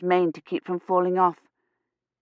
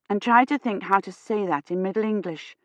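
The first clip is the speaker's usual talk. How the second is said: ordinary delivery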